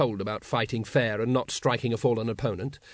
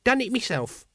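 high, cheerfully proud reply; happy; 10 semitones higher than usual. A narrator's reading. Brother tells Grand Master of his work, happily. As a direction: report the chore done, pleased as can be. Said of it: "said Brother Dunnikin happily".

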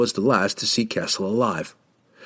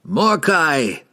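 high ringing shout of the name; 6 semitones higher, 3 dB louder than usual. A narrator's reading. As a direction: shout the name across worlds. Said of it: "Dendibar shouted".

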